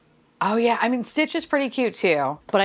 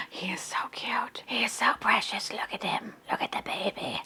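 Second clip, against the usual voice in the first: cooing whisper; 8 dB quieter than usual. Real, not acted. Cooing at cute figure.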